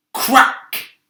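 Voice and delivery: crack! snapped out loud and high; a sudden cracking burst; loud sharp crack. A narrator's reading. Narrator sounding a hailstone striking, loud sharp crack.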